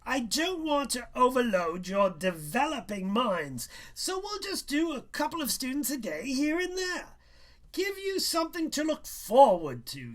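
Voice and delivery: high, loud and haughty schoolmaster; condescending teacher lecturing a student; pompous teacher. A narrator's reading. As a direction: talk down to the boy as a smug teacher. Teacher voiced in story.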